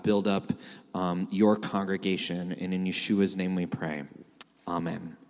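low, even praying voice; prayer. Real, not acted. Opening prayer.

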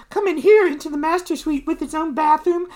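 high, fawning hostess voice; Debbie the socialite. Acted. Hostess showing her guest the master suite, high and fawning. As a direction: show off in a high lady's voice.

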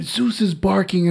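ordinary delivery

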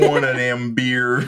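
lightly imitated Southern accent. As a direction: echo the Southern accent, laughing. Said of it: "Why is he doing that accent?".

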